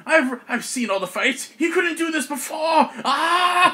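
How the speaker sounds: high-pitched, hammed-up disbelief; Argomon panicking. Game master as Argomon facing the beam, hammed-up high panic. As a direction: squawk it in comic alarm, far above your voice. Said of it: "Argomon's like".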